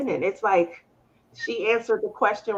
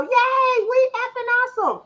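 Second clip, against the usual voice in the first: much higher, quick cheer; kid cheering; 11 semitones higher than usual. Retold or imitated. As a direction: chirp it like a happy kid. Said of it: "You know".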